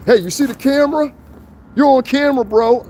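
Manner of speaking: high, whiny voice begging about the camera; mock pleading prankster. Mocking a prankster who got knocked down, high whiny plea.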